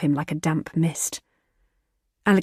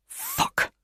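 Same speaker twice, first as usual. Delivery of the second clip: frustrated curse; 5 dB quieter than usual. A narrator's reading. Tripped on tree roots.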